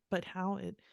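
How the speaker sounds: usual speaking voice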